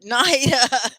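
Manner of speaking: a delighted laughing shout of a name. Real, not acted. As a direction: laugh out her name with joy. Delighted greeting of a viewer.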